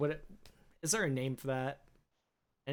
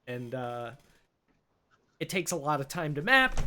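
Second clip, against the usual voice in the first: ordinary delivery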